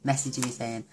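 ordinary talk

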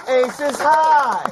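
high, loud comic catchphrase yell; mock shout. Comic yelling a bit from a friend's show, mock shout. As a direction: holler it out high, for laughs.

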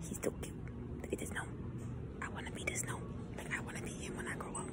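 soft breathy whisper over the gnome. Real, not acted. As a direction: hush it like a secret crush. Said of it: "whispering over the gnome".

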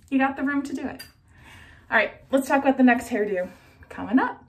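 muffled behind cloth mask; speaking with mouth covered; through a face mask. Real, not acted.

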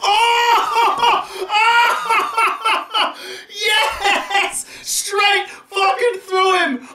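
very high, laughing, yelling with joy; ecstatic laughing shout. Real, not acted. Player cheers his bowler's wicket, laughing and shouting. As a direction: explode with delight as the stumps fall.

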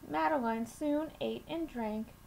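plain reading voice

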